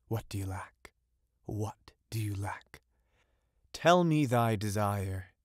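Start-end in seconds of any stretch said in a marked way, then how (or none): 1.5-2.8 breathy secret whisper of the question; eerie whisper. Acted. Witch whispering to the young fisherman. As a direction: hush it like a secret.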